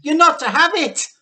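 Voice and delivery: very high, very loud cry; panicked boy shouting; George crying out. A narrator's reading. George cries out pulling the cup away. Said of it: "George cried out".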